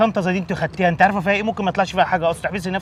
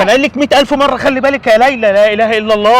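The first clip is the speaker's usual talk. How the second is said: loud exasperated scolding, raised pitch; angry scolding; 7 semitones higher, 10 dB louder than usual. Acted. Father yelling at careless daughter, loud and exasperated. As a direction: shout at her for never listening.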